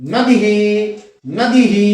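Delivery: नदी ही as "nadee-hee" with a held ending; visarga echoing long II. Shown as a demonstration. Teacher repeating for learners, long "hee" ending. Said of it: "दीर्घी की तरह ही बोला जाएगा".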